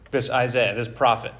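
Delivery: ordinary delivery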